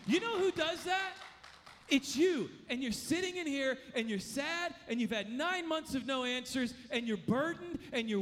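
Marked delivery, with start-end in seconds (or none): 0.1-2.5 higher, louder challenge on who; loud preaching emphasis. Shown as a demonstration. Preacher challenges the congregation, higher and louder. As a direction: drive the question home to the room. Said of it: "Let me tell you something".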